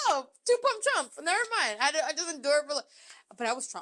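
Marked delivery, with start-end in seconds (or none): 0.1-1.8 laughing mock. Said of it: "That's so fucking sad".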